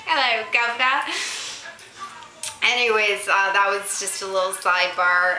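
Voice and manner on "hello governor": mock British greeting. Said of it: "And we're like".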